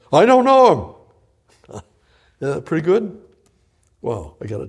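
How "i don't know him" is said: high, loud, laughing denial; daring denial. Acted. Preacher acts out Peter's bold lie to the crowd, high and loud. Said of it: "that's pretty daring".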